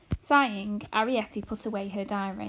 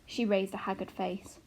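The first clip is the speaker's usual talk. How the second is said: ordinary delivery, nothing marked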